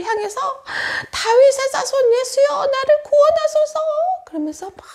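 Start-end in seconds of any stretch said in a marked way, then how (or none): 1.1-3.8 acted beggar's shout, stretched at the end; crying out loudly. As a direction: call far across a crowd for help. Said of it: "큰 소리로 외쳤어요".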